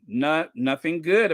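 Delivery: ordinary delivery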